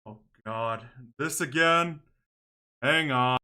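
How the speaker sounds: usual speaking voice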